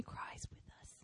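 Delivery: tender whisper, moved. Real, not acted. Telling the congregation God weeps with them, whispered. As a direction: drop to a whisper on it.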